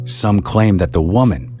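ordinary delivery, nothing marked

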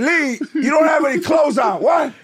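high startled outburst; shocked wife. Retold or imitated. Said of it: "my wife was like".

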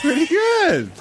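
very high, surprised approval; amused praise. Real, not acted. Cohost reacts to host's accent attempt, high amused praise. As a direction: squeal your approval.

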